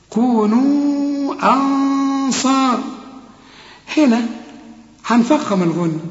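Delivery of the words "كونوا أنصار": slow chanted كونوا أنصار; slow recitation. Shown as a demonstration. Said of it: "الهمزة دي".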